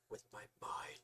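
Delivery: breathy whisper of a smug secret; mock mysterious whisper. Mock whisper bragging to friends about a mind-powered lift. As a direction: whisper it like a mystic reveal. Boasting about telekinetic strength.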